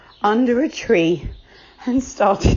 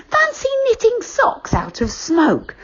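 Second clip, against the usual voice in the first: put-on much higher, bright-eyed boy voice; excited boy; 13 semitones higher than usual. Joe marvelling at the smoke-knitting shop, high and amazed. Joe's child voice.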